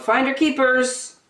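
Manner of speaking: called out high and bright; sing-song call across the pool; playful high yell. A narrator's reading. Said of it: "and yelled".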